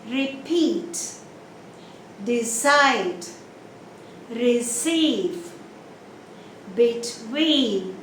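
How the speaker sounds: repeat, decide, receive, between, each leaning on its last syllable; short first syllable, long PEAT, CIDE, CEIVE, TWEEN; second syllable stress. Shown as a demonstration. Teacher lists verbs and prepositions, stress on each second syllable. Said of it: "the stress is on the second syllable".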